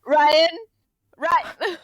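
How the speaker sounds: loud, high cry of a name; calling out. Acted. Saf to Ryan as he's about to let go, urgent shout. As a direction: shout to stop a friend. Saf calls to falling friend.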